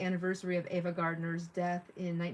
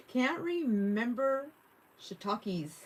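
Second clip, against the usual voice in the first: drawn-out repeat; 6 semitones higher, much slower than usual. Retold or imitated. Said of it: "repeating the chat line".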